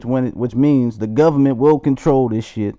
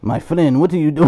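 put-on Dutch accent; 4 semitones higher than usual. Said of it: "do not question my accent".

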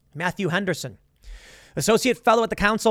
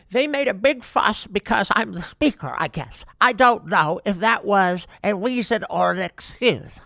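put-on politician impersonation, slowing as it goes; Nancy Pelosi; 3 semitones higher than usual. Host quoting her at a news conference, in a mocking impersonation. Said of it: "doing my impersonation of Nancy Pelosi".

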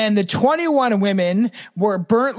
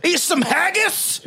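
put-on shouted higher Scottish accent; 6 semitones higher than usual. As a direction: belt it out in a hammed-up Scottish accent. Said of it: "fun to do a Scottish accent".

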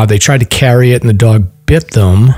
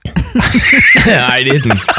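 laughing; 3 semitones higher than usual. Real, not acted. Joke about life insurance.

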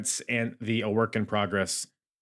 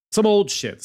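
emphatic exclaim; 11 semitones higher, 7 dB louder than usual. Real, not acted. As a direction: blurt it out with gusto. Stressing old wrestling footage.

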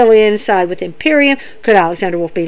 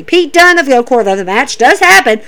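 usual speaking voice

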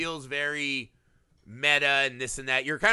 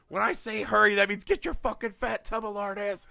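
high, strained scolding; hammed-up impatient boss yell; mock berating; 7 semitones higher than usual. Imitating the scummy reporter berating someone, high mock scolding.

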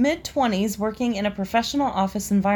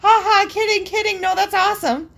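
high-pitched fake-laugh backpedalling; mock awkward cover-up, pitched high; nervous backpedal; 10 semitones higher, 4 dB louder than usual. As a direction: imitate hastily taking a joke back. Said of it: "as I tried to backpedal".

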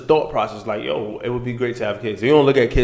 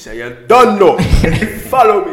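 imitating Jamaican accent; 9 semitones higher, 8 dB louder than usual.

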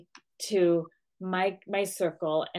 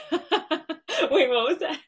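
high, loud words through laughter; laughing question; 7 semitones higher, 5 dB louder, much slower than usual. Real, not acted. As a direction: ask it while cracking up. Laughs while asking about code.

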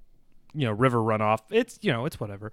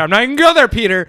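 much higher and louder, mock-exasperated; loud refusal; 9 semitones higher, 10 dB louder, much faster than usual. Real, not acted. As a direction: shout it down to shut Peter's bait.

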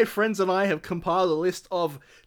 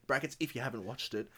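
quiet aside; 7 semitones lower, 11 dB quieter than usual. Acted. Parenthetical aside while reading.

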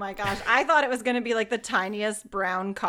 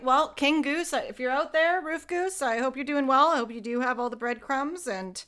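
mock address; 6 semitones higher than usual. Addressing the roof goose.

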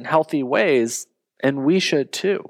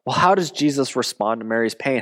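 normal talking voice

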